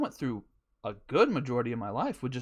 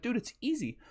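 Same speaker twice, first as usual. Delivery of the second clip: mock breezy advice; 6 semitones higher than usual. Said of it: "I'm like".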